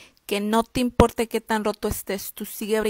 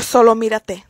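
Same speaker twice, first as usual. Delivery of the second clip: ordinary delivery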